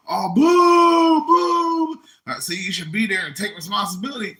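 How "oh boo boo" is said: high, loud mock booing; booing crowd. Mimicking the studio audience, loud high booing. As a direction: boo like the audience.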